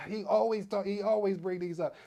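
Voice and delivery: high-pitched mock complaint; imitating complaining viewers. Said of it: "I know you guys get to say".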